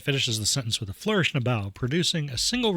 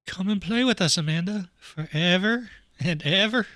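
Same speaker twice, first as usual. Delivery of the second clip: higher, eerie sing-song invitation; creepy twins; 6 semitones higher than usual. Acted. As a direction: lure her like the twins in a horror film. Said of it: "enough with the creepy twins".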